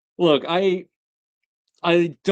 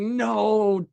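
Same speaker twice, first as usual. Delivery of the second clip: desperate heartbreak; 8 semitones higher, slower than usual. Retold or imitated. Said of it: "you can just see his heartbreak".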